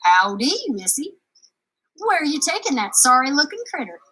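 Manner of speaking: put-on sly drifter sizing up a girl; lightly drawled stranger; stranger's voice.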